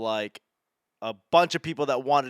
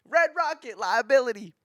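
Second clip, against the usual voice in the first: high, strained football-call shout; imagined coach yell; 10 semitones higher, 4 dB louder than usual. Pretend. Comic picturing Brady barking a play call at him, mock yell. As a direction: bark out a play call like a fired-up quarterback. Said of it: "yell something".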